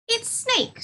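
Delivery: high little mouse voice; mouse character. Acted. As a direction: chirp it as a tiny mouse. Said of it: "said the mouse".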